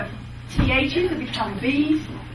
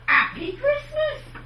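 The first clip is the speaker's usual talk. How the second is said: much higher, slow, sweet Cockney "Happy Christmas"; innocent Cockney greeting; 12 semitones higher, slower than usual. Shown as a demonstration. Showing fair performers an innocent Cockney greeting, high and slow. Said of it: "something very innocent like".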